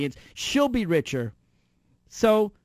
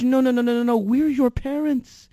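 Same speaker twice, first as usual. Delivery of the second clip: mock chauffeur; 5 semitones higher, 5 dB louder than usual. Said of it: "imagined servant reply".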